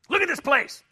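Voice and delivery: high, loud, fed-up complaint; mock angry husband. Husband gets mad at wife over the house, mock angry outburst. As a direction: snap at the messy house.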